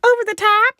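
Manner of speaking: high, loud squeak of alarm; panicked question. Retold or imitated. Her brain panicking at the register, high squeal. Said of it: "my brain's like".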